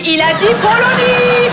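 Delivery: scandalised teacher exclaiming; outraged disbelief. Real, not acted. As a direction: stretch it out in shock. Outraged at pupil's mistake.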